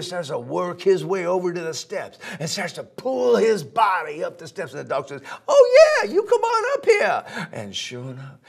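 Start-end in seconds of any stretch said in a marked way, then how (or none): 5.5-7.5 high, fast, eager sweet-talk; excited little dog luring the gator; coaxing dog voice. Acted. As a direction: rush to reassure him, way up high. Dog lures gator up the steps.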